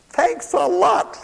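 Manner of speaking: high, drawn-out mimicry of a listener; mock sarcastic thanks. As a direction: play an ungrateful listener, high and slow.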